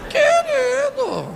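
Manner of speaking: very high, stretched-out mock wail; reação indignada encenada. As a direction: stretch it out in a comic high squeal. José reage à gravidez, encenado. (gemido agudo e esticado, cômico)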